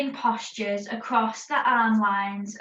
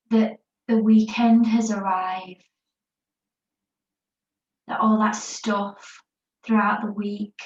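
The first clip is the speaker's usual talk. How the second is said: calm, unhurried yoga teacher voice guiding a rest pose; guided meditation; 3 semitones lower than usual. Acted. Yoga teacher guiding the class in child's pose, guided meditation voice. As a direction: ease the class into stillness, word by word.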